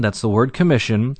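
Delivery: ordinary talk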